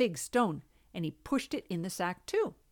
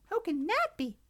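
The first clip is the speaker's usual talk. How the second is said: high sleepy mouse squeak; tiny squeaky critter; 12 semitones higher than usual. Acted.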